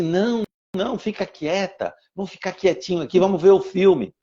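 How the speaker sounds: put-on a small boy gently soothing his sister; little boy comforting. Little son hushes his sister. (menino pequeno consolando a irmã, voz aguda e suave)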